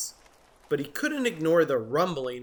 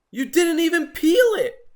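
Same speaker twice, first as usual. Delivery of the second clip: much higher, louder grumpy protest; high indignant Jim; 7 dB louder than usual. Acted. Grumpy Jim protesting to his neighbor Norman. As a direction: squeak it out in disbelief.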